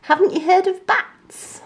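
high, amused put-down; cheeky monkey teasing; scoffing monkey. A narrator's reading. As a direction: laugh off his fear. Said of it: "laughed and said".

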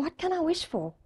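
pondering. Retold or imitated. Man thinking hard about his wish, soft musing. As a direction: wonder it softly to yourself. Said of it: "sitting there really hard thinking".

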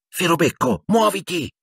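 high, strained hiss; urgent hiss. A narrator's reading. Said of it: "sibilò Harry". (sibilo acuto e pressante)

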